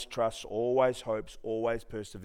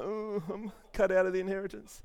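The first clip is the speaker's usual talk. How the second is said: mock panic; 7 semitones higher than usual. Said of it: "I'm like".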